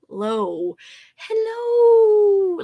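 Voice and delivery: ordinary delivery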